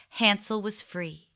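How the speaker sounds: plain reading voice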